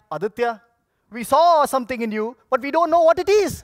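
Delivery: mock the boss, laughing loud. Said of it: "it sounded like".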